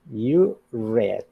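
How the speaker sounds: "read" said like "red" after "You"; short e in "read", as in "bed"; past-tense pronunciation. Shown as a demonstration. Teacher modelling the past in a phrase, "read" pronounced "red". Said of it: "Y se pronuncia read".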